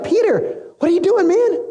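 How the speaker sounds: high-pitched incredulous mock scolding; acted exasperation. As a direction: play an exasperated teacher questioning his follower. Jesus reproves Peter after the attack.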